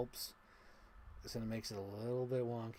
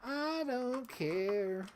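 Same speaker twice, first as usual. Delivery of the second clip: very slow, high drawn out; slow high shrug of a line; drawn-out I don't care; 12 semitones higher, 4 dB louder, much slower than usual. Real, not acted. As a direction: stretch every word, unbothered. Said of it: "like I said before".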